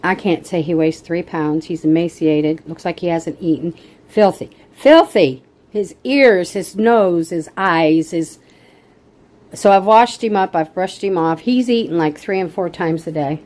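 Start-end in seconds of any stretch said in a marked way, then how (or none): 4.1-8.4 rising outraged cry; filthy. Real, not acted. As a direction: spit out the disgust, louder each time. Outraged at neglected cat.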